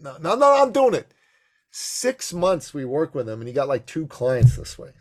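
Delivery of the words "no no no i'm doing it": much higher, louder protest; exasperated. Retold or imitated. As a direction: throw up your hands at him. Exasperated self-quote.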